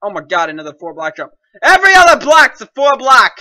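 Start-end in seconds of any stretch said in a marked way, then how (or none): 1.6-3.3 loud, high ranting; worked-up, high-pitched protest; exasperated yell. Real, not acted. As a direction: shout the complaint at the map. Ranting about the map's jumps.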